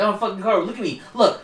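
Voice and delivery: high, insistent retold demand; mock angry yelling. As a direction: play a kid demanding his card back. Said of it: "He's like, yelling at the guy".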